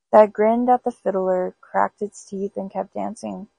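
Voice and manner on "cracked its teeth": ordinary delivery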